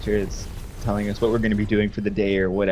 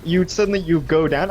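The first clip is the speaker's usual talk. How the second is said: normal talking voice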